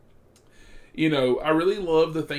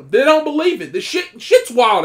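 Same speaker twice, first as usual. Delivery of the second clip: louder and higher, insistent; insistent raised voice; emphatic shout; 9 semitones higher, 12 dB louder than usual. Real, not acted. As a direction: drive the point home to the viewers. Insisting to viewers.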